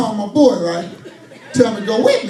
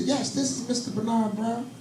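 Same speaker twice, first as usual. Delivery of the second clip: soft, proper phone answer; answering like a gentleman; formal phone voice; 11 dB quieter than usual. Retold or imitated. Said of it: "I said".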